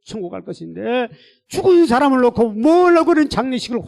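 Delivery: high, loud pulpit rebuke; fierce reproach. Retold or imitated. God reproaching pastor about lavish funeral, fierce rebuke. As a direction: pour out the scolding at full force. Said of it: "엄청난 책망인데요".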